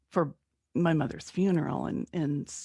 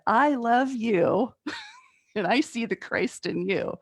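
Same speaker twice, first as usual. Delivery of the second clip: slow, higher blessing that breaks into a laugh; a lightly hammed preacher laughing through the blessing; mock preacher blessing; 5 semitones higher, slower than usual. To the angry woman, slow mock preacher blessing with laughter. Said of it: "I was gonna be a Southern preacher".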